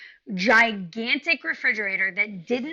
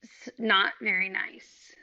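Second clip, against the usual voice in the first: drawn-out, pointed pause; slowly, choosing words carefully; very slow wry understatement; much slower than usual. Real, not acted.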